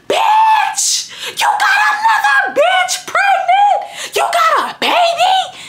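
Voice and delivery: high screaming fury; screaming at a cheating boyfriend on the phone; screaming accusation. Retold or imitated. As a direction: shriek it at him in disbelief. Confronting boyfriend about baby.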